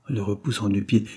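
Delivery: plain reading voice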